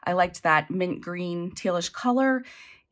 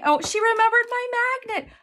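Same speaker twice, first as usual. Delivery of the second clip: much higher, excited delight; thrilled squeal; faster than usual. Real, not acted. Unboxing a heartfelt gift.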